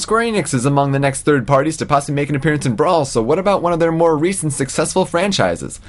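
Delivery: upbeat podcast host read; segment announcer. Acted.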